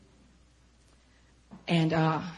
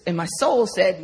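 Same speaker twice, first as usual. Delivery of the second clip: ordinary talk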